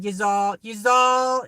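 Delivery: a light imitation of Maryland cousins' Southern accent. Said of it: "And they said".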